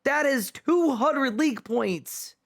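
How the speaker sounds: a touch of a mock announcer; mock hype announcer. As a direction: announce it like a fake highlight reel. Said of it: "And I'm just gonna say".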